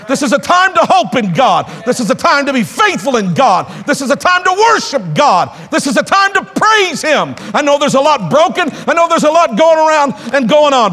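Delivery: high, driving sermon delivery; rousing pulpit exhortation; impassioned preaching. Real, not acted. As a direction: preach it hard, pressing the congregation. Preaches at full force.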